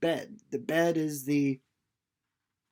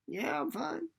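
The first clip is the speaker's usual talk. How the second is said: higher, softer reply in another voice; tired Winnie; 10 semitones higher, 5 dB quieter than usual. Retold or imitated. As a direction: brush off the worry softly. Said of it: "he says".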